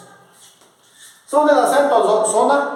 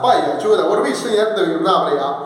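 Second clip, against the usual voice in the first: ordinary delivery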